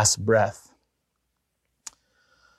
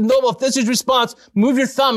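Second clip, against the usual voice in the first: higher, quicker eager asking; excited plea; 10 semitones higher than usual. Retold or imitated. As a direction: press him gently but thrilled, move your thumb. Urging Noble again.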